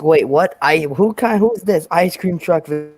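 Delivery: usual speaking voice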